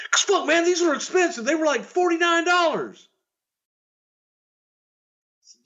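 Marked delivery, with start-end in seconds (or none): 0.1-3.1 much higher, defensive whine; high-pitched offended wrestler; indignant Tatanka. Retold or imitated. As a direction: defend the cheap shoes as pricey. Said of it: "Tatanka replies proudly".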